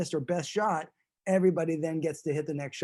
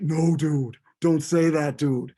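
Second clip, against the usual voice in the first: scolding dude; 7 dB louder, slower than usual. Acted.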